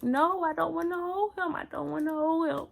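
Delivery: high, pleading refusal; begging not to, in grief; distraught refusal. Retold or imitated. Refusing to hold stillborn son.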